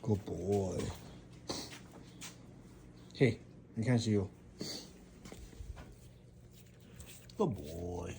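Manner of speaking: soft murmur to a pet; talking to a dog. Real, not acted. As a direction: hush it gently to the dog. Murmuring to dog while eating.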